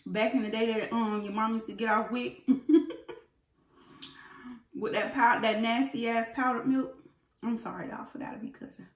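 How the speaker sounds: talking with food in mouth; speaking between spoonfuls of cereal; chewing while talking. Real, not acted. Seen 0: Spoon of cereal at mouth; jaw working, lips closed mid-chew.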